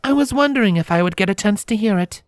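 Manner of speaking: teasing princess, pitched high. Acted. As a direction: poke fun lightly in a high voice. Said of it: "the princess said teasingly".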